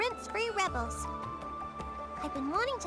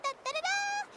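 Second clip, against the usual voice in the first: high vocal ta-da fanfare; triumphant fanfare; 10 semitones higher than usual. Acted. Writer unveiling her latest masterpiece, triumphant fanfare. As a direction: sing out a showy little drumroll flourish.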